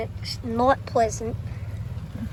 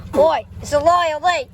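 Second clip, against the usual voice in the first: loud playful Australian voice, rising at the end; mock Australian accent; 4 semitones higher, 4 dB louder, slower than usual. Kid shouting at the train in a mock Australian accent. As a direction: shout it in a hammed-up Aussie accent. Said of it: "I'm gonna British accent".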